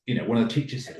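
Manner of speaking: usual speaking voice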